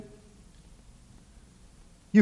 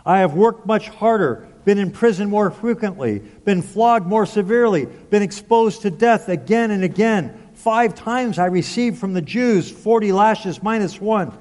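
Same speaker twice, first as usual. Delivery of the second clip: raised, driving scripture recital; higher-pitched, emphatic sermon delivery; impassioned preaching; 4 semitones higher than usual. Acted. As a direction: preach Paul's list of hardships, phrase by phrase. Reads Paul's list in preaching voice.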